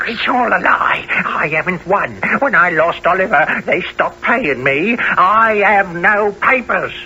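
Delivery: shrill panicked denial; frantic denial. Acted. Condemned man protesting wildly.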